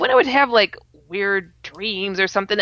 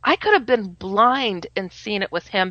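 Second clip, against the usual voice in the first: normal talking voice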